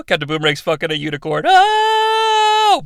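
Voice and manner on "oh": high mock wail. Mock soundtrack cry.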